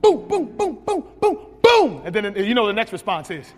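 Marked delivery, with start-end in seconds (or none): 0.0-2.0 high, loud boom boom of a tumble down stairs; loud mock thuds. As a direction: pound out each thud louder. Imitating falls, louder climax.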